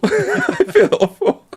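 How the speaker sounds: high, slow words through laughter; laughing. Real, not acted. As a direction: laugh helplessly while owning up. Laughing at own mockery of accents.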